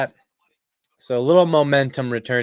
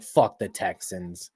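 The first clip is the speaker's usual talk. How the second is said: softer, lower, slow mumbled curse; a hint of grudging disdain, lower and slow; half-hearted curse; 7 semitones lower, 7 dB quieter, slower than usual. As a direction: mutter it without conviction. Imagined shrug at rival team.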